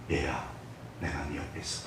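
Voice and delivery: very soft and low, nearly breathed; comforting voice of God. Acted. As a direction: reassure gently, as God at your side.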